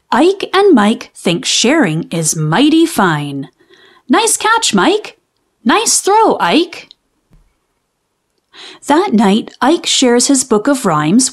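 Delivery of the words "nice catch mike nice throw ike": put-on very high, bright boys' voices; cheerful kids. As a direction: call out like happy little brothers playing. Boys now playing happily together.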